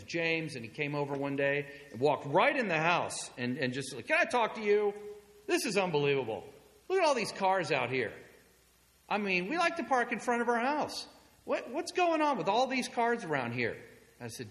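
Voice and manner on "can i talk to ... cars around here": loud, high, indignant complaining; angry neighbor. Retold or imitated. Neighbor barges in to complain about the cars, angry.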